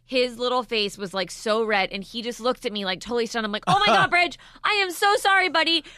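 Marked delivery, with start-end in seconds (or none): 3.7-5.8 high, loud, panicked apologizing; frantic apology. Retold or imitated. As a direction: re-enact a mother gushing apologies. Mother retells frantic apology to son.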